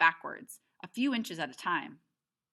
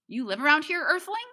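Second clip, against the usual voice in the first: much higher, louder playful question; mock alien greeting; 10 semitones higher, 6 dB louder than usual. Narrator teases the boy with his alien word, high and loud. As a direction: tease him back with his own word. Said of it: "I go".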